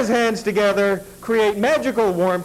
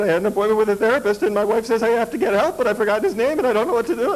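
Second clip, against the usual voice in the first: flustered rush; 3 semitones higher, faster than usual. Retold or imitated. Panicked plea to receptionist.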